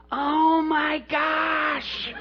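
a high, loud cry of amazement; imitating a stunned woman; amazed gasp. Veronica sees Bob's shoulder healed and cries out in amazement.

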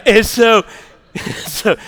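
normal talking voice

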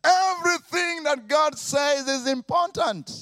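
high, loud shouted declaration; preacher shouting the point home; emphatic preaching. Real, not acted. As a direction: proclaim it at full force.

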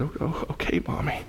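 soft whispered tearful reply. Retold or imitated. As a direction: whisper it like a tearful little girl. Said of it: "the little girl in tears said".

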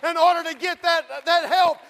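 loud, high, shouting sermon; fiery preaching. Acted. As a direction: preach it with full force. Preaching at full force.

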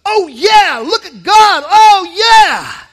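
loud, high, joyful shout of praise; loud, exultant mock praise; excited shout. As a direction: shout it out like you're overjoyed. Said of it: "You'd respond with a".

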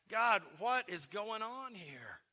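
high, slow, loud disbelief; exasperated cry to God. Retold or imitated. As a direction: throw it up to God in frustration. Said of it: "I'm just like".

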